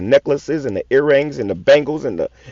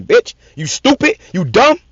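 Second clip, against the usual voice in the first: angry insults; 6 semitones higher, slower than usual. Real, not acted. Preacher insulting the women he is ranting at, angry and high. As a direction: spit the insults out in anger.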